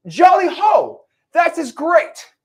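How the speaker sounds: very high, slow, loud toff; Monopoly guy. Retold or imitated. Buyer hearing of the deal, very high and delighted. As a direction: squeal it like a delighted old tycoon. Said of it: "They think it's the monopoly guy".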